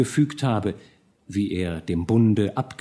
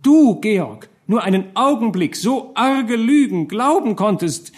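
much higher and louder, voice straining with hurt; hurt tearful outburst; 11 semitones higher, 6 dB louder than usual. A narrator's reading. Marie reproaches Georg, tearful and hurt. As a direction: cry out the reproach through tears. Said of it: "von neuem strömten ihre Tränen".

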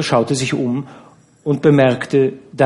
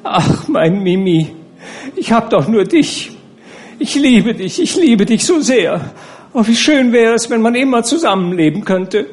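fit of tenderness; 11 semitones higher, 4 dB louder than usual. A narrator's reading. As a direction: throw yourself into his arms, cooing. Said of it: "bekam Nana einen Zärtlichkeitsanfall". (zärtlich schwärmend zu Mimi, hoch und innig)

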